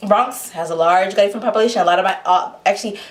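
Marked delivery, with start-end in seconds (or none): none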